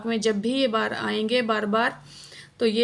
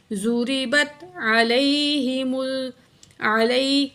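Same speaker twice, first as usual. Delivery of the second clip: Quran recitation; slower than usual. Shown as a demonstration. Teacher recites the verse.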